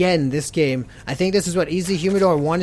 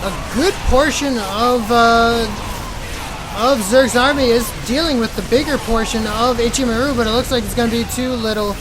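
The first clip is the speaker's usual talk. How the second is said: loud, rising caster hype; excited play-by-play; 4 semitones higher, 6 dB louder than usual. Real, not acted. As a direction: call the big fight. Big engagement cast.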